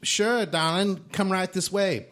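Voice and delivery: high, coaxing hostess; seductive dancer. Acted. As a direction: lure him to the back room. Dancer answers.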